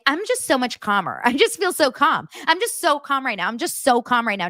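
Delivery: high-pitched, frantic claim of calm; mock-calm insistence. Mocks Christine claiming to be calm.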